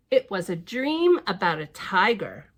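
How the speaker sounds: plain reading voice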